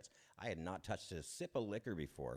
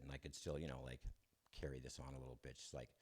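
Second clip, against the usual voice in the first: ordinary delivery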